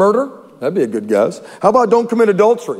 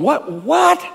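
baffled what; 9 semitones higher than usual. Acted. Onlookers baffled.

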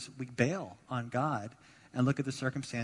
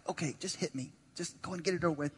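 softer, mock-resigned surrender; giving up; 3 semitones higher, 4 dB quieter than usual. Ball carrier about to get tackled, mock resignation. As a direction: imitate a player surrendering before the tackle. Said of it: "just kinda give up".

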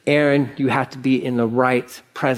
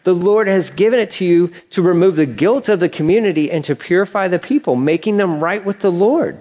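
higher, louder stern demand; 6 semitones higher, 3 dB louder than usual. A narrator's reading. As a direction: press it home like an insistent leader. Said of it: "he demanded".

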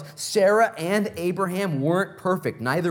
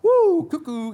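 high, loud hammed-up cackle of disbelief; mock Sarah laughing; 13 semitones higher, 6 dB louder, slower than usual. Preacher imitating Sarah scoffing at God's promise, high and loud. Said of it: "she starts laughing. She's like".

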